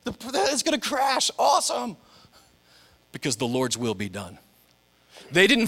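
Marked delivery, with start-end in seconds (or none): 0.0-2.0 high, giddy thrill-seeker; Murdoch's glee. Retold or imitated. Crazy pilot about to crash, high giddy glee. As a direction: relish the crash like a wild pilot. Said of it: "Or you could be Murdoch going".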